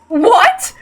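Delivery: a stunned woman reacting to a cold remark; shocked what. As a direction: blurt it out in shock. Ava shocked.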